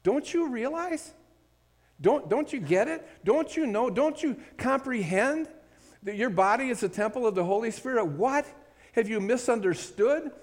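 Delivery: Paul scolding his church in high disbelief; exasperated Paul. Retold or imitated. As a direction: plead with the Corinthians as an exasperated Paul. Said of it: "it's as if Paul is saying this to the Corinthians".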